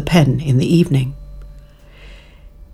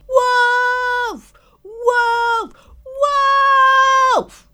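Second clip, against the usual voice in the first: practice screaming; much slower than usual. Acted. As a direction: try out the scream half to yourself. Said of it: "he practiced screaming".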